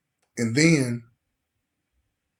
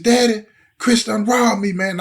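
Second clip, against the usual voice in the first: high, louder, urgent retelling of a scared son; retold scared emotion; 7 semitones higher, 5 dB louder than usual. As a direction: imitate a son trying to hold it together. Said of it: "I can hear the emotion in his voice".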